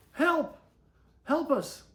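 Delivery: high, loud cries for help; crying for help. Acted. Stuck kids crying to rescuers for help. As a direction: call out in panic from above. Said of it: "they heard familiar voices crying".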